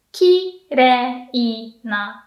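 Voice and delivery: slow "kirei na" dropping after ki; atamadaka pitch. Shown as a demonstration. Teacher modelling kirei na slowly.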